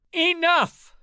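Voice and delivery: very high, loud command; bellowed. A narrator's reading. Queen Emmeline stops the brawling council, bellowing. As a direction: bellow to silence a whole room. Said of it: "she bellowed".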